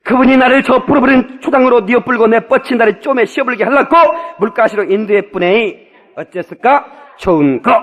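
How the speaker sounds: loud Jeolla-dialect psalm recitation. Acted. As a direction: proclaim it in broad Jeolla dialect. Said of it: "전라도 버전 다시 한번 읽어주려고 그래".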